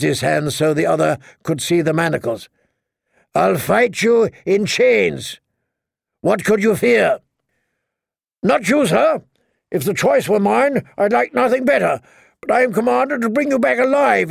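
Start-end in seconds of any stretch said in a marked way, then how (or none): 3.3-7.2 raised-pitch taunting challenge; calling out. A narrator's reading. Jamie goading the knight to fight, calling out. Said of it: "as Jamie called out". 8.4-14.3 high-pitched indignant reply; enemy knight calling. A narrator's reading. Old knight refusing Jamie's duel, high-pitched call. Knight calls back from galley.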